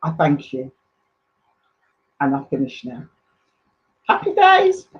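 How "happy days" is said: bright cheerful sign-off, much higher; happy. Real, not acted. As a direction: sing out a joyful farewell. Cheerful sign-off after speech.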